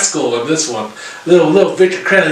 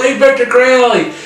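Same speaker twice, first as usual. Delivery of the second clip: higher, louder call across the water; 8 semitones higher, 4 dB louder than usual. Retold or imitated. Andrew calls out to distract Victor Crowley, a hammed-up shout. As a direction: shout to get the killer's attention. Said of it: "He goes".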